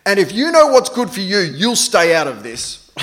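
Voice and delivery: loud mimicked kid threat, slowing at the end; child's stern warning. Father mimics his third child butting into the argument, loud stern warning. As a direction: warn them off like a bossy little one. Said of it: "these words came out".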